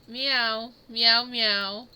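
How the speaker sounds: a lightly voiced meow, cat noises; cat impression. Covering the sneeze with a light mock cat meow. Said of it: "supposed to be a cat impression".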